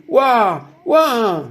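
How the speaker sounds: high, retold gasp of amazement; awed exclamation. Family reacts to the glowing speaker, awed exclamation.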